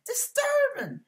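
high, hushed mock scolding; squeaky little reprimand, kept soft; scolding churchgoer. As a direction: imitate a fussy churchgoer shushing someone. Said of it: "somebody said".

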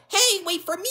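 very high little sidekick voice; high-pitched call to catch up; calling after. Acted. Sidekick left behind calls after friend, high and urgent.

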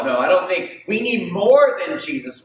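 ordinary talk, nothing marked

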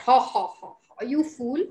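loud acted ha-ha laugh, then scorn; mocking laugh. Elephant laughing at firefly's request, mocking laugh. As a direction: laugh scornfully at the silly idea. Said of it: "The elephant laughed".

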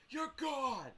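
high-pitched mock ejection holler; imitated umpire call. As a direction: throw him out like an ump.

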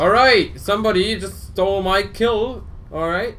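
loud, high outburst at a teammate; angry gamer yell; mad. Real, not acted. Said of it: "You mad, bro?".

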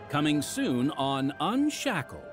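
ordinary talk

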